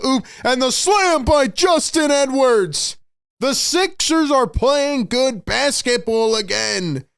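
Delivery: high, drawn-out big call; excited announcer shout. Real, not acted. Streamer calling a Sixers alley-oop slam, excited shout. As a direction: stretch the words in a soaring slam call.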